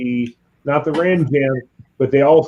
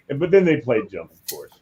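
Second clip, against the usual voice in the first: usual speaking voice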